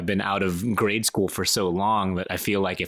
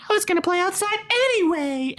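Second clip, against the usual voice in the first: put-on high, sulky child voice; bratty kid retort. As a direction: snap back like a put-out kid.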